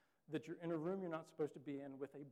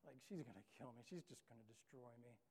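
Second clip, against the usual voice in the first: low, softer, dreading voice; 3 semitones lower, 7 dB quieter than usual. Retold or imitated. Child alone in room, fearing mom's wrath, softly dreading. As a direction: mutter it, sure of punishment. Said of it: "You're sitting there like".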